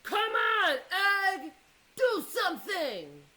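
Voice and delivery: loud, high shout at the egg. Acted. Father shouting at the egg to hatch, loud and high. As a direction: yell right into the egg's face.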